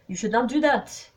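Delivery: reproving detective; reproachful. A narrator's reading. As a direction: scold firmly in a French accent. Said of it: "said Poirot reproachfully".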